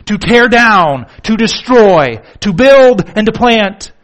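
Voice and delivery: fiery preacher hammering each word; full-force preaching. Acted. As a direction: thunder it from the pulpit.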